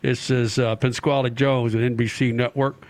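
a light, low put-on NBC network-head accent; mock an NBC bigwig's accent on a call, pitched low; put-on NBC executive accent. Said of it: "talk to Roger with that accent".

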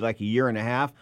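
normal talking voice

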